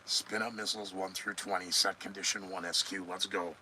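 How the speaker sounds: low, soft command aside; hushed launch order. Acted. Host play-acting a missile launch order, hushed.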